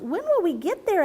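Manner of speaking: high impatient little frog. A narrator's reading. Restless young frog asking parents in a high voice. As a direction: whine it high like a restless child. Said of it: "asked another".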